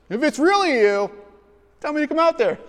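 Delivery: high, loud challenging Peter; cocky Peter. Retold or imitated. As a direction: dare Jesus to call him out. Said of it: "watch this, guys".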